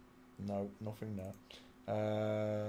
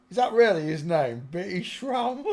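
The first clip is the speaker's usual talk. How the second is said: laughing disbelief; 7 semitones higher, 10 dB louder than usual. Real, not acted. Laughing at the odd name.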